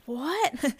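whispered, stunned question; mock disbelief. Client hearing her therapist say it's magic, whispered mock disbelief. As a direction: whisper it as if astonished.

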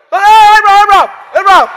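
high, very loud frantic call; urgent shout. Acted. God shouting to stop Abraham's knife. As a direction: yell his name to stop him. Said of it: "God, they went".

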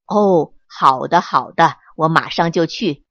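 low, steady doctor voice; calm old doctor. Acted. Woodpecker doctor calmly agreeing to come.

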